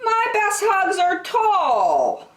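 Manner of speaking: put-on high, slow childlike musing; dreamy high bear voice; drawn-out high-pitched wish; Douglas's voice.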